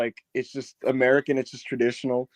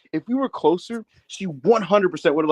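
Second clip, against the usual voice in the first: ordinary talk, nothing marked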